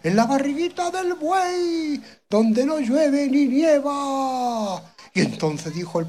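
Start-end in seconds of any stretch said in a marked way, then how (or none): 0.0-4.8 far-off little voice, much higher, slow and drawn out; Garbancito from the belly. Acted. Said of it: "dice el Garbancito".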